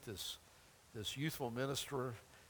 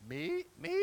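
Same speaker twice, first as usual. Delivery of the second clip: high, rising voice cracking up on "Me? Me?"; squeaky rising "Me?"; 13 semitones higher than usual. Retold or imitated. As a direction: let the voice jump up in disbelief. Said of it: "You can hear his voice going up".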